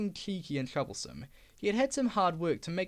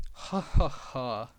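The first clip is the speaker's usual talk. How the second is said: lower, slow hearty ha ha ha; guffaw; 6 semitones lower, 4 dB louder, much slower than usual. Acted. Trucks enjoying Diesel's gossip, slow guffaw. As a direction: guffaw at the joke. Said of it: "guffawed the trucks".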